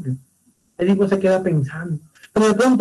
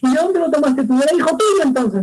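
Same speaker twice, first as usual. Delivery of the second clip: higher, demanding questions; harsh interrogation; 7 semitones higher than usual. Acted. Oedipus interrogates the shepherd, higher and demanding. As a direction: corner him with questions.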